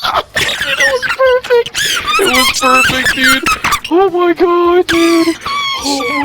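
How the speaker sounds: high-pitched squeal through real laughter; cracking up. Real, not acted. Friend overcome by the other's funny noise, cracking up.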